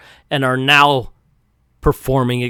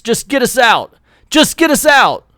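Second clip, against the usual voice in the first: urgent order; 10 semitones higher, 4 dB louder than usual. Acted.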